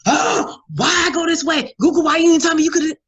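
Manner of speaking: high, loud, fast frantic complaining; frantic mock rant. Mocking herself stuck in traffic, a high frantic shout. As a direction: panic at the GPS in a stressed shout. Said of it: "And I'm over here like".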